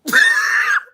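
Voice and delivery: very high, loud squeal of laughter; sudden piercing laugh; shrieking laugh. Real, not acted. Cracking up at Randall's story.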